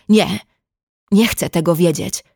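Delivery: a sudden loud outburst, half-held; sudden shout. A narrator's reading. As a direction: burst out at her with no warning. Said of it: "krzyknął nagle". (nagły okrzyk)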